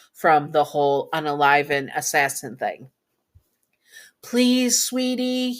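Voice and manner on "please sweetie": high, sweet pleading; coaxing, high-pitched plea; begging. A narrator's reading. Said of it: "begging your partner".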